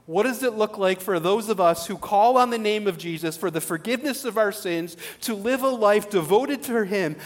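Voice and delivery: ordinary talk, nothing marked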